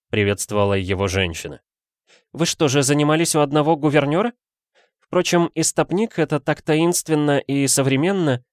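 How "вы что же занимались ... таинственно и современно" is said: high-pitched lady's voice; intrigued madame. A narrator's reading. Madame greeting the detective's stoker assistant, intrigued. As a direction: muse with interest, pitched high.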